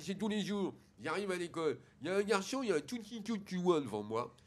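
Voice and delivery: put-on mushy consonants, childish explaining; speech defect. Son explaining the school trouble to his father, lisped. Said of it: "il parle comme ça".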